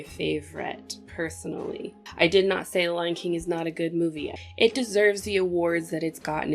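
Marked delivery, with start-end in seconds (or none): none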